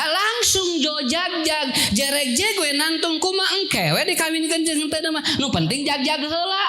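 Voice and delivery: high, rising storyteller excitement; excited telling. Acted. Preacher telling the congregation the paralysed man jumps up, excited. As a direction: sell the miracle cure with lifted energy.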